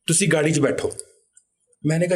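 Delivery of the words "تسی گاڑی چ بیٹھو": ordinary talk, nothing marked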